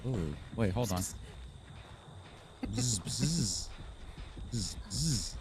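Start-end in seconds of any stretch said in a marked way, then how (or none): 1.0-4.8 soft low bzz buzzing; fairy buzz. Pretend. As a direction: buzz quietly like a little fairy. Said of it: "we are fairies and they buzz".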